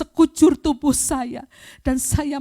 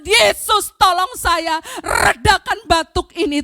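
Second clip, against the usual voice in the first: higher, insistent retold plea; crying out to Jesus; 5 semitones higher, 5 dB louder than usual. Woman retelling her cry to Jesus, urgent plea. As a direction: call out as if begging for help. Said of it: "Saya teriak".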